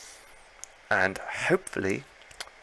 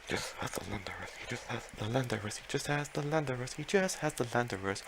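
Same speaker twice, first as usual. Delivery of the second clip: softer, almost whispered repetition; hushed; 8 dB quieter than usual. Real, not acted. One Landorus left, hushed tense murmuring. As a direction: murmur it to yourself, holding your breath. Hushed repeating near victory.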